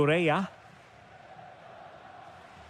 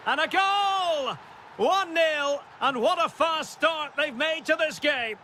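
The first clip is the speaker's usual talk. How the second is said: excited goal call; 6 semitones higher than usual. Real, not acted. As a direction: ride the thrill of an early goal, pitched high. Commentator calls the opening goal.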